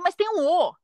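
retold protest. Retold protest to correcting boyfriend.

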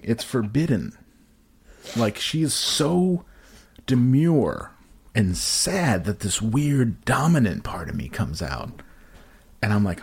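ordinary talk, nothing marked

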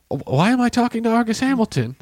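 high, bewildered why am I; baffled question. Retold or imitated. Robin Williams confused at talking to Argus, high bewildered voice.

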